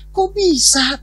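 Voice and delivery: high-pitched disbelieving question; incredulous inner reaction. Retold or imitated. Pastor thinking to himself about the confession, incredulous. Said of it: "Dalam hati saya".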